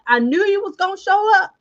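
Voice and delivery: high, excited movie-villain imitation; Joshua, excited. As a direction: mimic his giddy outburst, pitched well up. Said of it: "He was so excited".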